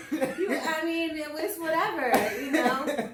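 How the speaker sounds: high, giggly laughing; amused by a cheeky hint. Real, not acted. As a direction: laugh through it. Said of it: "laughing at the bribe joke".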